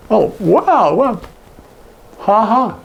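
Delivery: dry, mocking wow with a fake laugh; sarcastic ha ha. Shown as a demonstration. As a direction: roll your eyes as you say it. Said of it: "somewhat sarcastic".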